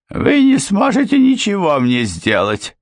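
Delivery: housekeeper, high and desperate; desperate objection. A narrator's reading. Housekeeper objecting in despair to Miss Trevick. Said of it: "с отчаянием возразила та".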